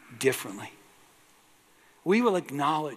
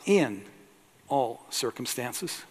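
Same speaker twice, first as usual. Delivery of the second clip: emphatic correction on "in"; stress on in; 4 semitones lower, slower than usual. Shown as a demonstration. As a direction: hit "in" hard, then settle.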